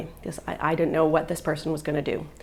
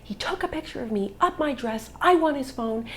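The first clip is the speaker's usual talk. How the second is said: higher-pitched, restrained mock shout; raised indignant call, volume held back; retold scream; 7 semitones higher than usual. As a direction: re-enact crying out to bystanders. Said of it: "I'm screaming".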